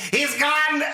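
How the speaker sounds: very high freak-out cry; Loomis. Retold or imitated. Loomis giving her no time to answer, panicked impression. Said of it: "he just freaks the fuck out".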